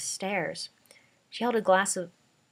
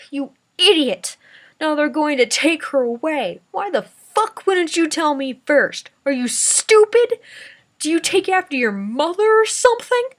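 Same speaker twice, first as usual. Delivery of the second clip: furious shout; 10 semitones higher, 11 dB louder than usual. A narrator's reading. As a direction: tear into the girl in rage. Grandpa screaming after slap.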